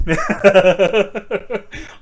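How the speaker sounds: loud embarrassed laugh. Real, not acted. Teased about chewing.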